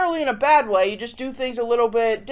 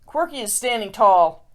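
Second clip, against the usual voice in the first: lively rhyming read-aloud; storybook reading. A narrator's reading. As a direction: read it like a picture book to kids. Reads a rhyming picture book.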